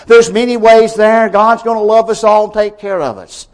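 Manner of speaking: high, loud, dismissive mock voice of the worldly; a scoffing caricature of people who think every road leads to God; mocking the rich. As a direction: lampoon the self-assured rich, brushing it off. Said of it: "everybody in the rich will say".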